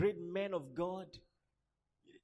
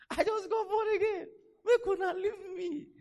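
high, loud mock plea through laughter; laughing, high-pitched mock complaint; laughing protest; 12 semitones higher, 7 dB louder than usual. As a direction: protest helplessly while cracking up. Retells his protest, laughing.

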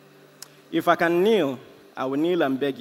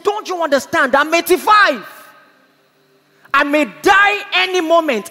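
high, loud urgent plea; impassioned retold cry of urgency; anguished grandmother; 9 semitones higher, 9 dB louder than usual. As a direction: voice a desperate old woman's plea, preacher-style.

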